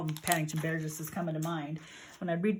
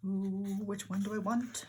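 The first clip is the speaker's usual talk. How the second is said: ordinary talk, nothing marked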